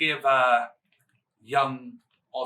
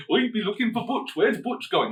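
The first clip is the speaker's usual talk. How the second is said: a light, hammed-up Yorkshire accent, a touch higher; 6 semitones higher than usual. Mimicking Ridge Holland hunting for Butch, in a bad Yorkshire accent. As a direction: do a bad Yorkshire man asking where Butch went. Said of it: "That's my Yorkshire accent".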